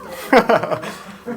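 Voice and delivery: a sudden high, breathy laugh; burst of laughter. Real, not acted. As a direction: laugh out loud at the question. Asked about dream game.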